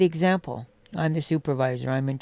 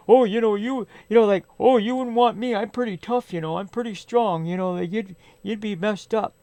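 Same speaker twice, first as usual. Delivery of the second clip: higher-pitched mock bragging; imitated tough-guy boasting; 4 semitones higher than usual. Other person claiming he's pretty strong, mocking imitation. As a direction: mimic someone puffing himself up. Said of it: "he thinks he's Mister Tough Guy".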